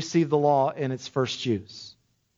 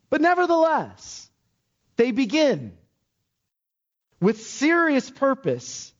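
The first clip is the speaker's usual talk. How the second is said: high, slow, emphatic preaching; fervent preaching; 7 semitones higher than usual. Acted. Pastor to his congregation, fervent emphatic preaching. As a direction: lift the catechism answer with force.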